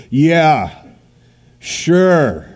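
mock the promise with disbelief; sarcastic. Preacher voicing doubt of the verse, sarcastic and loud.

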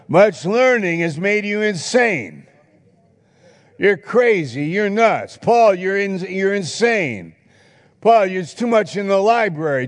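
high, loud accusing shout. Acted. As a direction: shout Paul down as a madman. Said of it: "You did it with a loud voice".

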